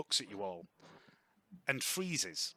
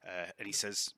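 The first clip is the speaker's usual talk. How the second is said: normal talking voice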